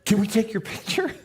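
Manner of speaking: high, giggly fan voice, lightly done; imitating excited young fans. Young women ask the author for a photo, giggly and high. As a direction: squeal it like an eager fan.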